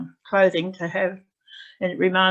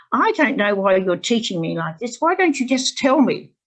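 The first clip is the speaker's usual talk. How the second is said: acted indignant complaint, rising higher and louder; irate; 4 semitones higher, 5 dB louder than usual. Student complains to her teacher about the method, irate. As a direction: imitate an exasperated student's protest. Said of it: "she was getting quite irate".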